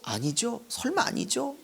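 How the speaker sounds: soft, anxious double-checking; hopeful mock prayer; pleading denial in prayer. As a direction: plead quietly for a no. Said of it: "막 이렇게 하면서 기도를".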